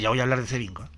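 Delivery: usual speaking voice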